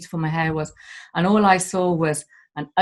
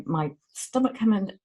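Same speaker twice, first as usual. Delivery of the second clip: ordinary talk, nothing marked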